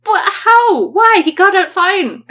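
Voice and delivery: exasperated. Acted. Exasperated in-character protest.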